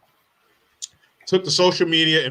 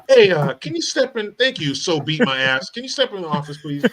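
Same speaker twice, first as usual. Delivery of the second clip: mock manager; 6 semitones higher, 4 dB louder than usual. Playing the TJ Maxx manager.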